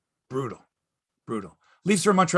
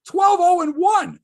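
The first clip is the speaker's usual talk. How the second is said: higher, louder, slow shout of the record; excited emphasis; 8 dB louder, slower than usual. Real, not acted. Repeating the goalie's record to Mike, higher excited shout. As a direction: belt out the stat in disbelief.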